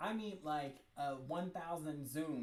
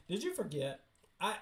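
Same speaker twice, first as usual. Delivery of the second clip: normal talking voice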